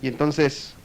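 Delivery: usual speaking voice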